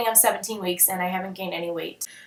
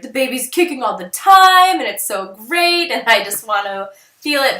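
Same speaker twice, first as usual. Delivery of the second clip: excited gushing; 2 semitones higher, 7 dB louder than usual. Retold or imitated. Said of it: "people that at seventeen weeks are like".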